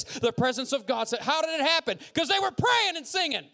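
rising to a high, loud shout; full-force preaching. Real, not acted. Preacher explaining prisoners' miracle, full-force preaching. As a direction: drive the sermon up to its peak.

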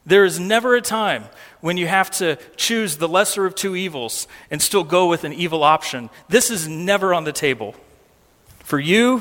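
ordinary delivery

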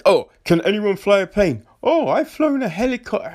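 high, fast whiny mocking voice; mock panicked passenger. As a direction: sneer at the clueless passengers.

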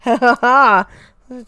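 real giggles around the word; laughing. Real, not acted. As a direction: crack up at your own joke. Cracks up at own imitation.

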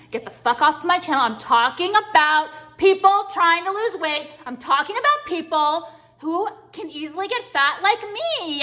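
angry rant. Real, not acted. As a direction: tell the critics off at full volume. Ranting at nutritionist commenters.

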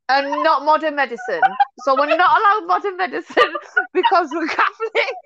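high incredulous retort through giggles; laughing disbelief. Retold or imitated. Answering the exorcism suggestion, laughing in disbelief. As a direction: repeat the absurd logic, laughing.

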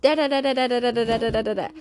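imitating nonstop rapid babble; rapid-fire chatter. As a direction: rattle off syllables like a chattering kid. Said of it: "ninety to nothing".

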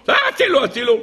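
high, panicky mock yelp; acted cry for help. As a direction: imitate a strangled soldier calling for rescue. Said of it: "וקרא ככה". (קריאת הצלה מבוהלת בחיקוי)

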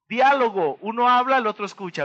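ordinary talk, nothing marked

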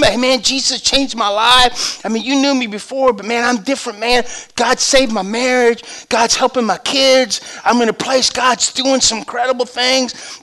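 imitating a friend preaching, pitched high; fervent testimony. Phil tells the guy what Jesus did, fervent preaching. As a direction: testify eagerly, stretching the phrases. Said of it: "He starts preaching for him".

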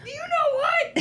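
very high squawked imitation; mock outburst. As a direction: imitate an indignant spouse way up high. Imitating a loud couple's quarrel.

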